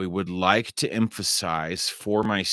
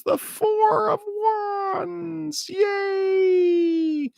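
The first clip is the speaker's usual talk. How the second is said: squeaky mock cheer; slower than usual. Mock cheering over the tarot cards.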